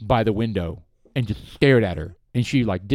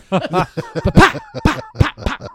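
loud popping "pa" noises through laughter; playful imitated gunfire; mock gunshots; 6 dB louder than usual. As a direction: fire off pretend shots with your mouth. Jokingly imitates gunshots at the dog.

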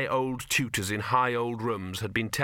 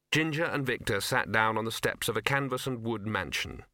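ordinary delivery

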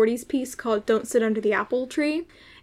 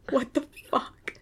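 slow, hushed disbelief; stunned whisper; 3 semitones higher, 7 dB quieter than usual. Retold or imitated. Student reacting to the director's order, stunned whisper. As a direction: whisper it slowly, as if dumbfounded.

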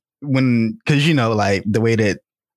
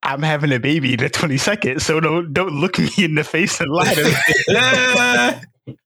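angry daughter; 6 semitones higher than usual. Retold or imitated. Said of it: "she's literally like".

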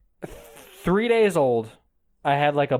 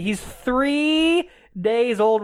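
higher, long-stretched protest; indignant mother; 6 semitones higher, much slower than usual. Retold or imitated. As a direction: drag it out in appalled disbelief. Mother refuses surgery for baby.

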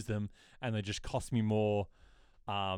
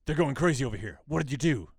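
lightly acted agitated caller, louder; louder, higher, as if phoning amid chaos; Doyle; 4 semitones higher, 7 dB louder than usual. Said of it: "you get the phone call from Doyle".

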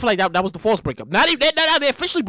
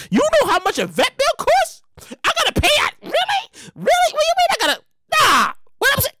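high incredulous outrage; 13 semitones higher than usual. Real, not acted. Ranting at the beer company, high and incredulous. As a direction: voice shooting up in disbelief.

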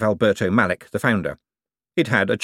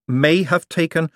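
higher, slowed "may have taken"; insistent, high-pitched "may have taken"; pointed correction; 9 semitones higher, 4 dB louder, slower than usual. A narrator's reading. One corrects the other's wording.

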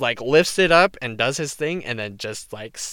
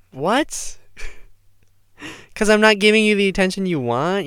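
mocking Steve; 7 semitones higher, 5 dB louder than usual.

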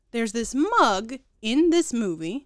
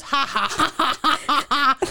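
sister's cackling laugh, higher; mock laugh; 4 semitones higher, 4 dB louder than usual.

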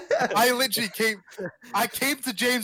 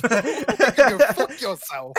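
laughing; 4 semitones higher, much slower than usual. Real, not acted. Laughing mock insult.

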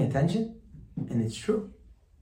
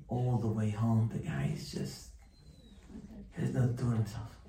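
ordinary delivery

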